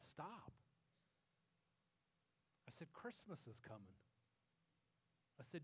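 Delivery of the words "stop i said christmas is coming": retold in a much softer voice; hushed firm appeal. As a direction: quietly halt them, recalling the moment. Calming angry, grieving family.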